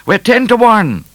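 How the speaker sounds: high, loud taunting call. A narrator's reading. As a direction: call it out to the one you are chasing. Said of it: "called O'Grady".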